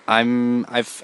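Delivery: ordinary talk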